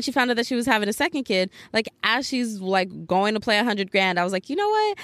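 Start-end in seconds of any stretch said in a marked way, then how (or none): 4.5-5.0 1 high exclaim. Retold or imitated. Narrator's own quoted realization.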